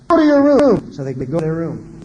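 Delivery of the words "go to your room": put-on high and loud, sending the kid away; sharp command. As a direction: order him off to his room. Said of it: "You're saying".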